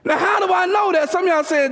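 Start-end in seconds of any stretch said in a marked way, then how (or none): none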